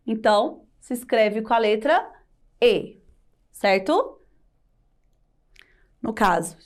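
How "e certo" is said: ordinary talk, nothing marked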